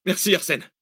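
high jubilant thanks. Acted. Zach thanking Arsène, jubilant. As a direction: cheer him on. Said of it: "jubila Zach".

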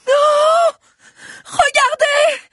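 very high, loud shriek; urgent high-pitched cry of alarm; lung-tearing scream. A narrator's reading. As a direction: scream to make everyone look up. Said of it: "cria plus fort encore Alix, à s'en déchirer les poumons".

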